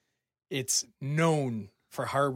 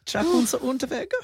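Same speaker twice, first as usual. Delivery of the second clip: put-on loud, high German-accented name; mock German accent; 11 semitones higher, 7 dB louder than usual. Host repeating killer's name for laughs, high mock German accent.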